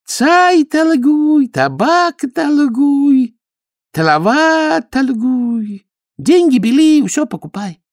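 high, slow, boastful broken Russian; proud Chinese suitor. A narrator's reading. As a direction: brag about riches. Khodya explains his trade.

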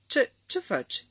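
sharp, high snap of alarm; snapping. A narrator's reading. Husband snapping at the intruder, high and sharp. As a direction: bark the question at him, rattled. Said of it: "se răsti domnul Dinsmed".

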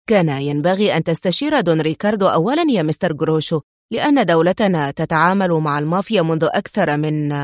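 ordinary delivery

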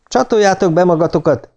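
understated shout to crewmates; called-out order. A narrator's reading. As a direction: call out an urgent order to the crew, without a full shout. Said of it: "kiáltott oda a többieknek".

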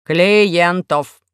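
loud, stretched mechanical word; supply the word like a slow machine; robot prompt. A narrator's reading. Robot prompts the faltering girl, mechanical voice. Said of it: "подсказал робот".

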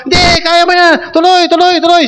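pushing a blindfolded player to keep crawling, shouted; coach shouting encouragement. Retold or imitated. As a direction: urge him on like a coach on the field. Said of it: "pero tatagalogin ko".

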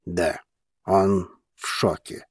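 low, slow, dazed reply; bewildered. A narrator's reading. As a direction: answer slowly, at a loss. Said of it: "растерянно ответил Вулкан". (растерянный медленный ответ низким голосом)

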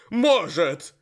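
loud high growled retort; рычит. Acted. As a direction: snap back a growl. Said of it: "рыкнул в ответ на ящера Буцефал". (громкий рык)

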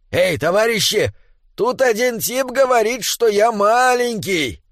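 high, indignant dwarf call; rally the others with an outraged cry, drawing out the end; calling out to comrades. A narrator's reading. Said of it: "принялся кричать". (возмущённо кричит товарищам)

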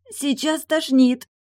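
high, strained, slow gasp of sickness; about to be sick; nauseous cry. A narrator's reading. Wife crying out as she doubles over.

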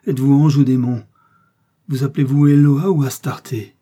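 loud, awestruck questioning; exclaimed. A narrator's reading. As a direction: call out as if overwhelmed by a vision. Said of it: "s'écria-t-il en joignant les mains".

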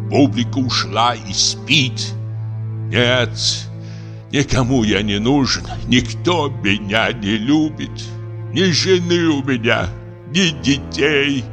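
slow, plaintive self-pity; lonely old drunk lamenting his life; mournful cry, drawing out the endings; forlorn old actor. Acted.

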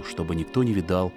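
ordinary delivery

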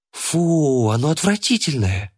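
put-on high girl's voice, grossed out; disgusted exclamation. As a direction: squeal it in disgust. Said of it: "воскликнула она".

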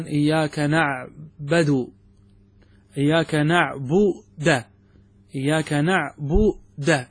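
نعبد with the dal given a fathah, na'buda, slowly; slow mispronounced نعبد, final a on the dal; slow wrong reading. Shown as a demonstration. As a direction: drag out إياك نعبد ending نعبد in -da. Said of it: "يفتح الدال".

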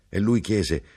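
usual speaking voice

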